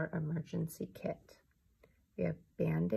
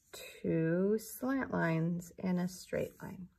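slow and deliberate; much slower than usual. Shown as a demonstration. Teacher modelling the strokes.

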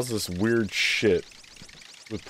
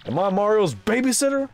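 Bowser; 9 semitones higher than usual. Acted. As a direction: bellow like an annoyed king koopa. Bowser complains about Mario.

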